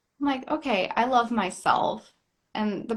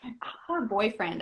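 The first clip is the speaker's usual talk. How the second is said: ordinary talk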